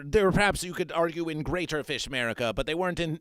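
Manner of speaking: ordinary delivery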